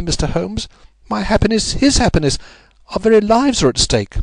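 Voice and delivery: high-pitched, impassioned lady pleading for help; Lady Hilda, desperate. A narrator's reading. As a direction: plead for your husband's happiness.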